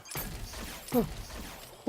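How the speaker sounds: high breathy relieved laugh; relieved laughter. Real, not acted. Laughs after close call.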